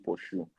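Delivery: "pursue" as a soft "paw-shoo"; regional pronunciation. Shown as a demonstration. Host explaining where she comes from, "pursue" softly as "paw-shoo". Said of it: "we pronounce it".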